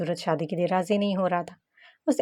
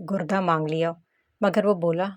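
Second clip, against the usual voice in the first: ordinary delivery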